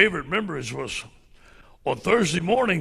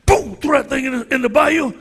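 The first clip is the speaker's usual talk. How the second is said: high, loud exclamation; excited boom; 10 semitones higher, 6 dB louder than usual. Retold or imitated. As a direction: slam it out like throwing the shovel. Throwing the shovel, loud exclamation.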